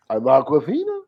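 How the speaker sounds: mock Harvey Fierstein voice, climbing high; Aquafina. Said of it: "steal Harvey Weinstein, Harvey Fierstein".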